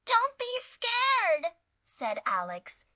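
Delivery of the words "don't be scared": shaky brave reassurance. A narrator's reading. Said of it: "even though his voice shook".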